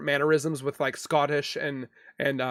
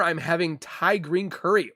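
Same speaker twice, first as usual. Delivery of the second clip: ordinary talk, nothing marked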